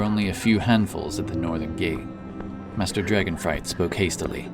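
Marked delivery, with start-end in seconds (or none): none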